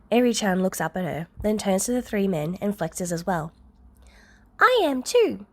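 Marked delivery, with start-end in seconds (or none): 4.6-5.5 put-on high, louder child voice; a small girl boasting; proud little Eri. Eri shows the men her muscles, high and proud.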